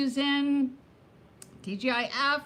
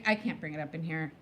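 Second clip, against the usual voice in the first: usual speaking voice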